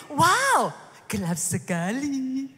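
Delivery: slow, drawn-out gelap sekali in awe; mock amazed wow. Preacher mocking God's complaint of darkness. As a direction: ham up an awestruck complaint. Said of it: "ngomongnya gini".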